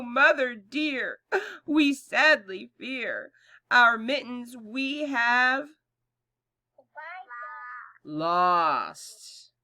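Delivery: singsong rhyme with laughter; nursery rhyme recital. Acted. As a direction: chant the rhyme for little kids.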